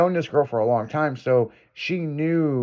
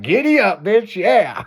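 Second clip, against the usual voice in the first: mock cowboy holler; 7 semitones higher, 4 dB louder, slower than usual. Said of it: "fucking Jimmy's like".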